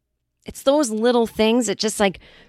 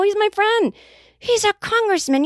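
pitched way up, protesting her innocence; indignant high protest; 13 semitones higher than usual. Retold or imitated. Pitched-up protest to her scolding dad. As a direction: squeal it, defending yourself.

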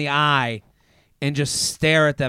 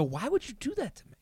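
hushed, higher-pitched retold plea; quiet, embarrassed complaint; mortified aside; 5 semitones higher, 14 dB quieter than usual. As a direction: hiss it softly at mom, mock mortified. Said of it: "I looked at her, I go".

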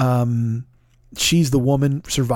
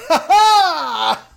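high, loud burst of delighted laughter; joyful laughing shout; 13 semitones higher, 4 dB louder than usual. Retold or imitated. Fan reliving his reaction to the solo, loud joyful laugh. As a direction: shout out a laugh of pure joy. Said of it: "and I just go".

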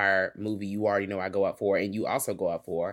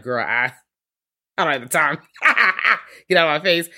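sassy dismissive quote; 5 semitones higher, 7 dB louder than usual. Retold or imitated. Retelling vampire dismissing police.

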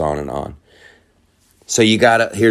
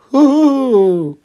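high loud mock whoop; mock celebration, high and loud; sarcastic cheer; 6 dB louder than usual. Real, not acted. Sarcastic cheer after list.

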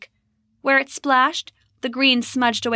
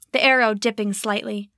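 usual speaking voice

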